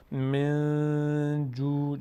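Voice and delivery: slow level chant, من جوع with the n hummed through the nose; nasalised hidden n. Shown as a demonstration. Teacher demonstrates ikhfa, nasalised hidden n. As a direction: hold the n of من in the nose, then draw out جوع.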